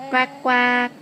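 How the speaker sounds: loud, crisp playful quacking; duck quack. Pretend. Parent prompting a child with yellow duck, loud quack. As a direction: quack out loudly for a toddler. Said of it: "How about yellow duck?".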